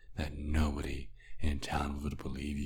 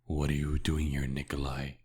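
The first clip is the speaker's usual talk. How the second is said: ordinary talk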